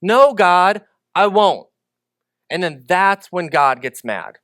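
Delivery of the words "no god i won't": stubborn refusal. Retold or imitated. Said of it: "Moses is like".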